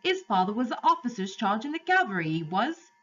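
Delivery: high-pitched cabby, dropping aitches; urgent cabby. Acted. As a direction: urge them as a cabby who knows horses.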